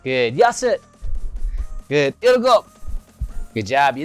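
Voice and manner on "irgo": shouted count. Shown as a demonstration. Shouted count during push-ups.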